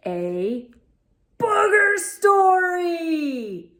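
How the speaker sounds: excited title announcement. Acted. Announcing the story title to kids.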